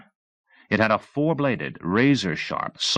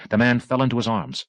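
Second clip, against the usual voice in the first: plain reading voice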